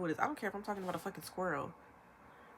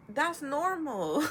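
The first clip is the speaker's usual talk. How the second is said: slow, loud and higher; emphatic drawn-out verdict; 7 semitones higher, 8 dB louder, much slower than usual. Real, not acted. Vlogger insisting to viewers that venting is normal, slow and loud. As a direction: stretch it out, insisting.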